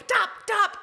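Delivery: high, squeaky repeated "dop"; rapid-fire mimicry of the wife; mocking echo. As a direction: parrot it back to tease. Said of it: "him going".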